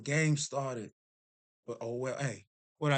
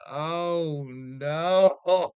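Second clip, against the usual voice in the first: mock horror; 2 semitones higher, 3 dB louder than usual. Dawning horror at the daddy jersey story.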